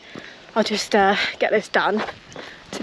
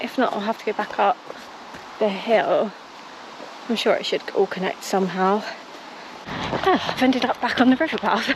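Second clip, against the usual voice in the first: ordinary talk, nothing marked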